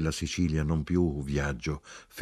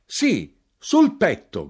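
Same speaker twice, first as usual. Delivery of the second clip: high, loud emphatic cry; short, forceful high exclamation; shouted; 11 semitones higher, 9 dB louder, slower than usual. A narrator's reading. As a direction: shout a triumphant confirmation. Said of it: "gridai io".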